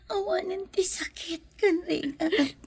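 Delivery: tearful whispered words; dying mother whispers through her tears; weeping whisper. Acted.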